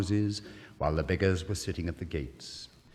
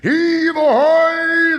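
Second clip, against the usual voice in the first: very high, very loud, long drawn-out call; sailors' shout; 18 dB louder, much slower than usual. Acted. Sailors shouting as each chest comes up. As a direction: haul and bellow it across the deck. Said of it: "they shouted".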